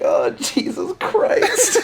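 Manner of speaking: high laughing exasperated shout. Retold or imitated. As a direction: cry it out through laughter. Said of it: "I was like".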